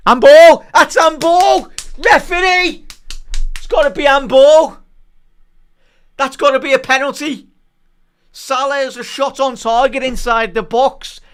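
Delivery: loud, high protest yells at the referee; real furious appeal for a penalty; outraged shouting. Real, not acted. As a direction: yell for a handball in disbelief. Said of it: "sorry to overreact there".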